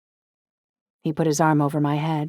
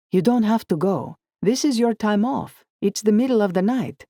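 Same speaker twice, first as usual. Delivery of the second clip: lightly voiced insistent boyfriend, a bit higher; Dante pleading; 6 semitones higher than usual. Boyfriend urging her to stay home, mild coaxing.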